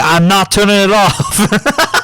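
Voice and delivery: slow, high, laughing refusal; defiant refusal. Retold or imitated. Antonio refusing the teacher, laughing defiance. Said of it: "he was like".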